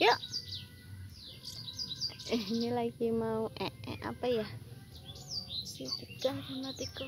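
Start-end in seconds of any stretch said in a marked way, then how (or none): none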